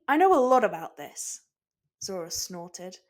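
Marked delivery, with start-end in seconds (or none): 0.1-1.4 loud offended protest; indignant. Acted. Sanji protesting Zoro's jab, indignant and loud. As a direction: defend your pride loudly.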